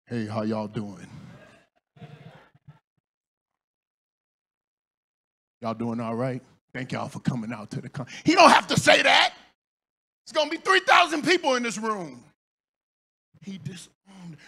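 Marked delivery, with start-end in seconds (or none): 0.1-8.1 T D Jakes voice. Retold or imitated. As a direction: drop deep like the bishop greeting a crowd. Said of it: "And he said".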